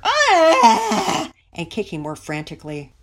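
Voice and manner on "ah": high, very loud wailing scream; louder toddler cry. A narrator's reading. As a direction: wail at full volume like a toddler. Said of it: "began to cry louder".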